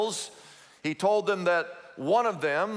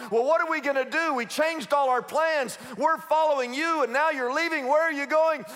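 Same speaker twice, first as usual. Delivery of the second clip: high, fast, panicky voice; disciples freaking out; 7 semitones higher than usual. Retold or imitated. Disciples panicking to Jesus, high fast worried voice. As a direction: fret in a rush like scared followers. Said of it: "they all start freaking out like".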